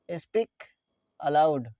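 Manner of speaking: "Speak", pause, "aloud", spaced for learners; word by word. Shown as a demonstration. Teacher repeats phrase word by word. ("Speak aloud" एक-एक शब्द रुककर)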